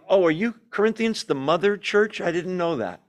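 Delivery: high-pitched mock surprise; total sarcasm. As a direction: feign being impressed, sarcastically. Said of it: "total sarcasm".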